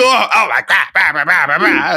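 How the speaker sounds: high, loud, mock-outraged squeal; hammed-up shocked drunk lady; offended drunk women. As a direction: overplay the indignant gasp, then babble. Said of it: "they go".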